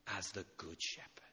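breathy hushed whisper. Acted. Preacher drawing the church into quiet, whispering. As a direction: let it fall to a whisper. Hushed close of a devotional line.

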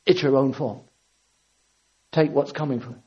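low, softened, acted verdict; quiet condemnation. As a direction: pronounce blame quietly, letting it drop. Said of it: "he has every right to say".

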